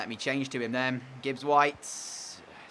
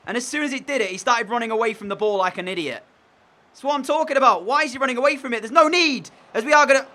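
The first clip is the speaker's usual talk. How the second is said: much higher and louder, yelling at the screen; furious rant; 8 semitones higher, 6 dB louder than usual. Real, not acted. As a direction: vent your fury at the game. Raging at the game's player switching.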